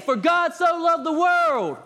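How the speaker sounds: high, loud, slow proclamation; preacher's full-voice declaration; shouted with conviction. Shown as a demonstration. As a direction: shout it like you mean it. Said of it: "Say it like you mean it".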